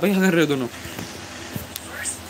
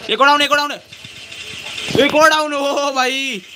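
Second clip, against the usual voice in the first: excited callout; 11 semitones higher than usual. Real, not acted. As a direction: shout the enemy count. Callout in a gunfight.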